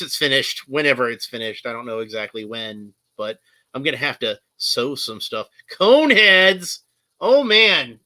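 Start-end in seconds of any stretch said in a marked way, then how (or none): 5.7-8.0 high, loud outburst; excited exclamation. Real, not acted. Suddenly spotting something to talk about, loud exclaiming. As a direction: burst out with sudden recognition.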